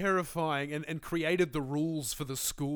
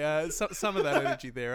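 ordinary talk, nothing marked

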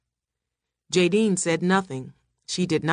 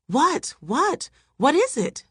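high, urgent questions; alarmed questioning; 10 semitones higher, slower than usual. A narrator's reading. Characters rush to the screaming woman, alarmed questioning. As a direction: pitch each question up in alarm.

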